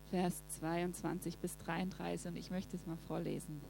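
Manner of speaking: usual speaking voice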